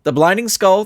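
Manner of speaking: ordinary delivery, nothing marked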